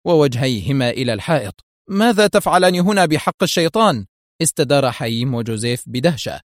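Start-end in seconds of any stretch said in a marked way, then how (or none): 1.9-4.1 high-pitched, startled demand; acted outburst of surprise; astonished shout. A narrator's reading. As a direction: cry out in amazement. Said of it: "وصاح أحدهم بدهشة".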